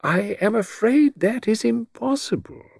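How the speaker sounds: put-on high, gently regretful old-friend tone; regretful refusal. A narrator's reading. Mister Satterthwaite turns down a plea for the picture, regretfully. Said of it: "shook his head slowly and regretfully".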